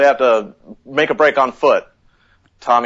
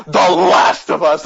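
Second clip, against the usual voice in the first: ordinary talk, nothing marked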